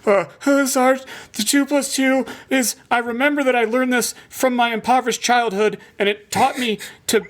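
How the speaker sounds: mock a flustered recruit dodging the question; rambling candidate. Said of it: "the people are like".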